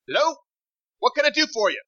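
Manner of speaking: high-pitched woman on a call; intense, high female character voice. Acted. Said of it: "intense female voice".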